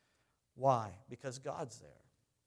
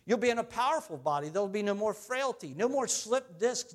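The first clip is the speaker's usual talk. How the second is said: normal talking voice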